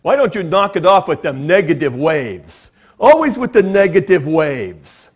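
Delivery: put-on quoting the crazy tank driver in his strange accent; strange Sutherland accent. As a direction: put on Donald Sutherland's odd movie accent. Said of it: "he's got this really strange accent".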